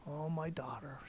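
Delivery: put-on whispered softly, like comforting a child; tender whisper. Jesus whispering to the healed woman, tender. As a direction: hush it out tenderly.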